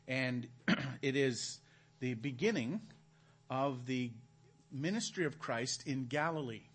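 ordinary delivery

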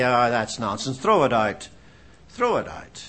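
low, scoffing brush-off; mocking dismissive skeptic. Preacher mocking intellectuals who scoff at scripture, low and dismissive. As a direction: sneer it away like a know-it-all. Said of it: "They say".

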